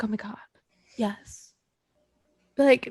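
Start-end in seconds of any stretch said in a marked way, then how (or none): 0.0-1.4 soft, breathy hush of delight; awed whisper. Retold or imitated. A stunned fan whispering to herself. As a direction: whisper it like she can't believe it.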